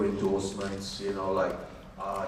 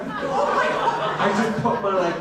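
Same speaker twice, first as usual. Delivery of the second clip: laughing disbelief; 11 semitones higher, 9 dB louder, slower than usual. Retold or imitated. Amazed his hometown was chosen.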